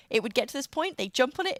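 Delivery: ordinary delivery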